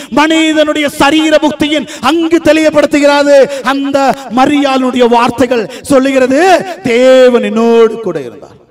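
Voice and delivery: high, driving sermon delivery; impassioned pulpit proclamation; full-force preaching. Acted.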